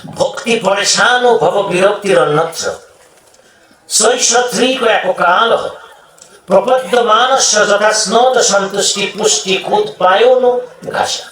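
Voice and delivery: measured, chant-like Sanskrit verse; scripture recitation. Acted. Preacher reciting Bhagavat verse to the congregation, scripture recitation. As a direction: recite the shloka with sustained syllables.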